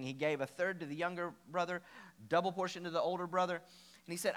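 ordinary talk, nothing marked

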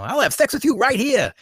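high-pitched mock protest; upset, over-insistent. Quoting George trying to prove a point, upset and over-insistent. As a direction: blurt it high, playing very upset. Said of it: "He's the one very upset".

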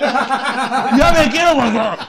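put-on slow, laughing child's plea; whiny kid on ride. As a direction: beg to get off like a scared kid. (niño en el carrito, lento y entre risas)

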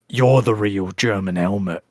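low retort after a sigh; mock put-down. Host acting out Hemi's reply to Chad, low mock put-down.

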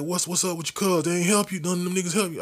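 uncle's voice pitched high and pressing; concerned uncle. Retold or imitated. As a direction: demand answers like a protective uncle. Uncle questions him, retold.

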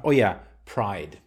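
ordinary delivery

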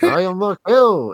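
high, slow, loud parody; hammed-up declaration; mock villain line. Host mocking a dumb movie villain, hammed-up role voice. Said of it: "And it's like".

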